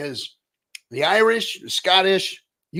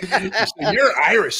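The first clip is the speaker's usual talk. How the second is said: laughing; 4 semitones higher, 4 dB louder, slower than usual. Real, not acted. Host laughs at Irish reveal.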